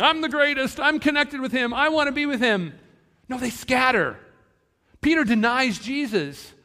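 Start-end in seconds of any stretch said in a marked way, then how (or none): 0.0-2.7 high, loud boast of an eager follower; hammed-up, high-pitched bragging; mock eager boasting. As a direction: play disciples clamoring for credit, pitched well up. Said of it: "Are the disciples running and saying".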